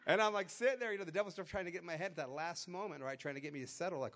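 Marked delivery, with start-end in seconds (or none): 0.1-1.8 excited. Retold or imitated. Excited retelling at the court.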